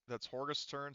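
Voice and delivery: usual speaking voice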